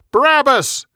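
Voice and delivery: high, loud shouted demand for Barabbas; loud mob cry, pitched high; crowd yelling. A narrator's reading. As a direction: yell it like a crowd making a demand. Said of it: "They yelled".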